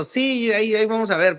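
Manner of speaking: high-pitched, eager retold yes; excited grandmother. Grandson retelling his grandmother's reply to his keyboard wish, excited. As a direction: lightly imitate an excited grandmother saying yes. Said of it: "se emocionó".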